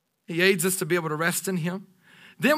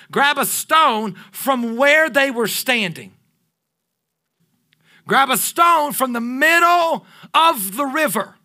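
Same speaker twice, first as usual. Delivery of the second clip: slow, loud and higher, like a proclamation; booming divine command; 4 semitones higher, 4 dB louder, slower than usual. Acted. The Lord commanding Joshua, slow and loud. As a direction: proclaim each order of the Lord slowly. Preacher voicing the Lord's command.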